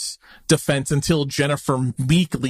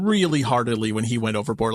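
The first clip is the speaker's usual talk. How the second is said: usual speaking voice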